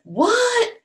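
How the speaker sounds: puzzled surprise; mock astonishment; surprised. Sign says don't wake snake.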